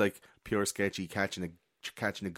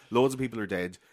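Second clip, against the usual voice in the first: ordinary talk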